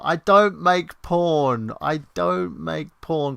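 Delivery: slow, loud insistence, drawn out; emphatic denial. Real, not acted. Streamer insisting to a chat joke, slow and loud. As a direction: spell it out firmly and slowly. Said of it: "He makes the porn now".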